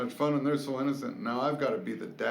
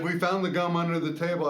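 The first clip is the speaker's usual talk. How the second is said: put-on excited kid; 6 semitones higher, 5 dB louder, faster than usual. Son's reply, retold excitedly.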